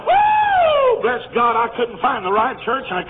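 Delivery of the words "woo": a loud, high whoop of joy; joyful holler. Real, not acted. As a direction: let out a big happy woo.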